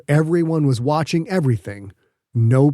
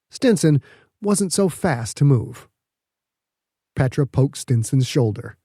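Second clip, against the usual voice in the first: ordinary delivery, nothing marked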